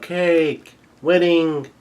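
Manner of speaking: two separate high-pitched calls; mock yell. Big Mom demanding her wedding cake, high mock yell. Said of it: "She yells out".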